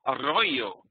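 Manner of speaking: "Arroyo" with a strongly rolled Spanish R. Shown as a demonstration. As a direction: roll the R's in "Arroyo" like in Spanish class. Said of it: "could pronounce my R's or roll them like that".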